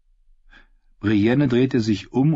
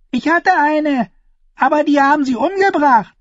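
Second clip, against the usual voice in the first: put-on small girl; 6 dB louder than usual. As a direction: say it plainly like a small child. Another girl adds.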